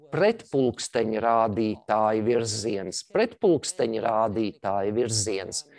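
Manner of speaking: pretpulksteņrādītāja virziens read slowly, stress on the first syllable pret; careful slow reading. Shown as a demonstration. Teacher reading a long Latvian word aloud for learners. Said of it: "I will try to read it for you".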